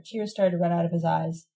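usual speaking voice

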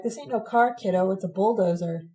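ordinary delivery

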